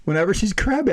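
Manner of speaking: normal talking voice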